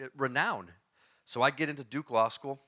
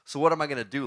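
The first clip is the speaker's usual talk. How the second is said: normal talking voice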